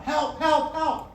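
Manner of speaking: high, loud cries for help; trapped tiger calls to someone above, high loud yells; yelling for help. Acted. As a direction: call up out of the pit. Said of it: "he starts yelling out".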